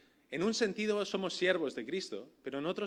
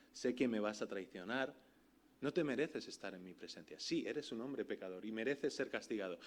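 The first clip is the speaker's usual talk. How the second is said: softer, a hint of a cold judging voice; stern condemning Jesus; 5 semitones lower, 5 dB quieter than usual. Preacher voicing Jesus condemning Peter, hushed and stern. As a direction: pronounce judgment on the sinner, quietly. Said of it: "Esto es lo que Jesús le tendría que haber dicho".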